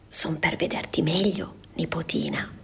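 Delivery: hushed, sly whisper; wolf's whisper. Acted. Wolf whispering to the granddaughter. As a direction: whisper it like a secret.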